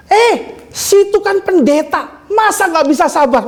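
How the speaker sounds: menirukan omelan kesal, bernada tinggi; nenek kesal. Retold or imitated. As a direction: omeli si pendeta dengan nada melengking naik, dibuat-buat. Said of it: "Dia kesal, dia ngomong". (tiruan tetangga yang jengkel)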